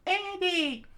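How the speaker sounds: very high, loud protest; high loud cry. Acted. Fuko crying out to Andy, high loud protest. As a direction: cry his name out.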